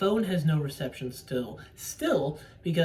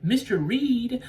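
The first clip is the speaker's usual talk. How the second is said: teasing "Mister Reed?", higher and drawn out; quoted teasing; 7 semitones higher, 4 dB louder, slower than usual. Retold or imitated. Paxton teasing Hugh Grant about his name, mock teasing. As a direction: call the name slowly, pitched up. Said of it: "She's like".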